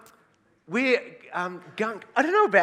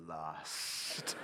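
breathless whisper of the word Lust; whispering what the crowd would think; 8 semitones lower, 6 dB quieter than usual. Retold or imitated. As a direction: hiss it like a scandalised secret. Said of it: "They're gonna be thinking".

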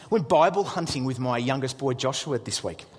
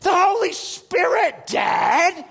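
high, loud, drawn-out insistence; hammed-up exasperated kid excitement; excited son; 13 semitones higher, 5 dB louder, slower than usual. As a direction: imitate a boy hammering the point to Dad. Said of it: "He goes".

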